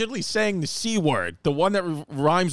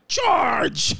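mock shout; 9 semitones higher than usual. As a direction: yell it like a cavalry charge, hammed up. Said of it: "do you just run around my credit card like".

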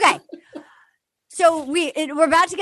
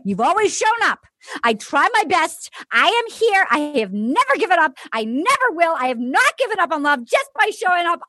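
dramatic monologue; 5 semitones higher than usual. Retold or imitated. Host re-enacting a contestant's pledge to love, as dramatic monologue. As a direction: build to a grand, hammed-up finish. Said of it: "That's your new monologue".

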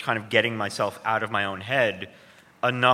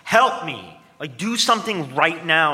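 loud, raised-pitch mock plea; urgent retold outburst, louder and higher; retold scream; 7 semitones higher, 7 dB louder than usual. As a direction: re-enact screaming at the therapist for help. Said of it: "come in and scream at him".

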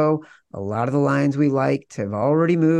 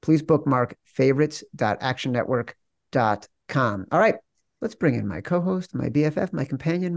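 ordinary talk, nothing marked

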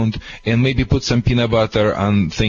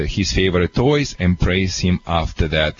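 normal talking voice

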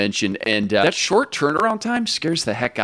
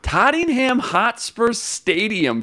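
lightly kept mock British accent; a trace of the put-on British accent; faint hammed-up British accent; bad British accent; 9 semitones higher than usual. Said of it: "That accent was horrible".

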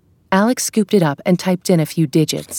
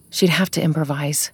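plain reading voice

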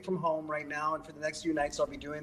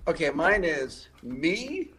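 usual speaking voice

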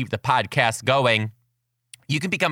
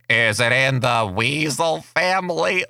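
put-on deep froggy kid voice. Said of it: "super deep raspy, like, froggy voice".

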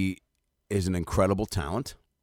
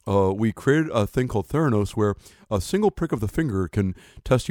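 Elizabeth Holmes impression; 3 semitones higher, 4 dB louder than usual. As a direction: play a fake-confident startup founder selling herself. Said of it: "I'll do my Elizabeth Holmes".

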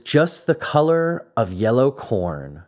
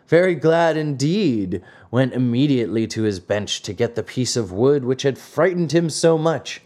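ordinary delivery, nothing marked

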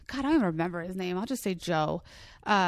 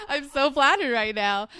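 high laugh into a louder flattered line; real laughter; 6 semitones higher, 5 dB louder than usual. Real, not acted. Reacting to her cohost's joke, laughing. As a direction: laugh it out, delighted.

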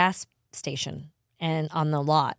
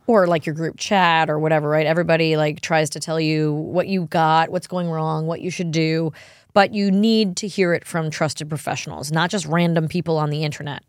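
louder, upbeat sponsor pitch; ad read; 6 dB louder than usual. Acted. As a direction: sell it like a podcast ad.

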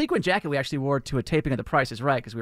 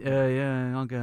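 softer imitation of a mumbling teen; teenage mumble; 2 semitones lower, 6 dB quieter than usual. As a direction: mumble it quietly like a teen worker. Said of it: "he gave me the teenage mumble".

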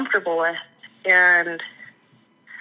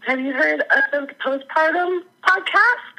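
imitating friend; 8 semitones higher than usual. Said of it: "and she's like".

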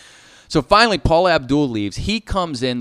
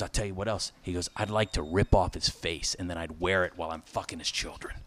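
much softer and lower, a retold calm madman; quiet menace; 9 semitones lower, 13 dB quieter than usual. Charlie Sheen's rant retold, low and soft. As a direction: keep it low and unhinged.

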